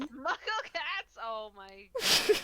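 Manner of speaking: slow, loud, high "Muggle cats?"; delighted disbelief. Real, not acted. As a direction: savour the phrase in amused delight. Delighted reaction to the joke.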